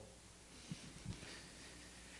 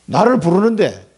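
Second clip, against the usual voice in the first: normal talking voice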